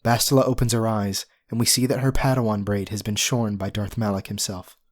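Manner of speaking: ordinary talk, nothing marked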